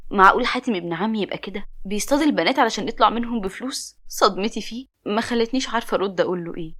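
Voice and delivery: plain reading voice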